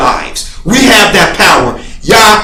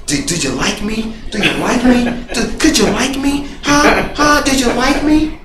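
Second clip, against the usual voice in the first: insecure pleading; 3 semitones higher, 4 dB quieter than usual. Retold or imitated. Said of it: "To the point where I'm like".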